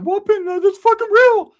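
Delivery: squeaky panicked cousin voice; high-pitched frantic whine; high nervous voice. Retold or imitated. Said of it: "he's like".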